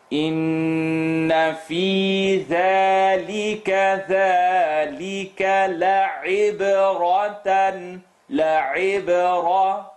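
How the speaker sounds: melodic chanted recitation; Quran recitation. Shown as a demonstration. Teacher recites Quran verses.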